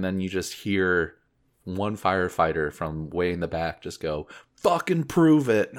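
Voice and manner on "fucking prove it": loud, slow, laughing heckle; heckling shout. Retold or imitated. Firefighter in the back daring the actor, loud heckle. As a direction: yell it from the back of the room as a dare.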